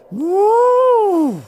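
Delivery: very high, loud, sustained moo; mammoth moo. Acted. Startled mammoth bellowing a moo at the boy. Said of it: "the woolly mammoth went".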